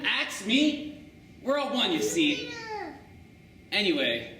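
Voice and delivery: plain reading voice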